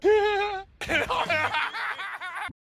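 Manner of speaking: bursting laugh, high; real amused laugh. Real, not acted. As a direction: laugh at your own joke. Laughing at her own impression.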